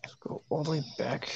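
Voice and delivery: fast whisper. Real, not acted. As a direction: whisper it quickly to yourself. Whispered repeat of own line.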